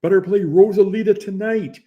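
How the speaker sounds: loud, high shout across the room; calling out to the DJ friend; retold yell. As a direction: yell the request to the stage. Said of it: "And Ronnie yells".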